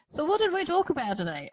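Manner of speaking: mimicking the host with an Australian twang; Australian accent. Child quoting the parent's podcast question, slight Australian accent. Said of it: "not gonna say it in an Australian accent".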